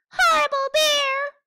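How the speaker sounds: high, loud furious "Horrible bear!"; angry shout. Acted. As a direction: yell it in a tantrum.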